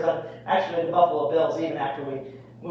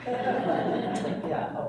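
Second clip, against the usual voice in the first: ordinary delivery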